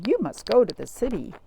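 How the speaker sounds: ordinary delivery, nothing marked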